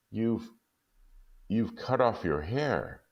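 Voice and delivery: a husband too stunned to grasp it, slowly. Acted. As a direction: let each word sink in slowly. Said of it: "asked Jim slowly".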